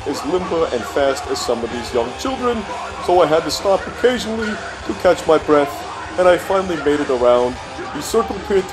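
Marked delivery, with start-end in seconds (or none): none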